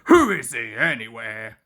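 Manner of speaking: low, scornful big engine; sneering. A narrator's reading. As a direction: scoff at the newcomer. Gordon sneering.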